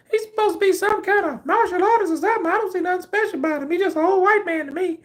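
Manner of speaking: very high, fast, dismissive mock voice; imitating Melissa. Coworker brushing off Chuck Norris, high fast mocking voice. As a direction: rattle it off high and snooty. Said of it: "she had this high-pitched voice".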